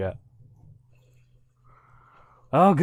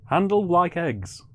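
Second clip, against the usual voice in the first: ordinary delivery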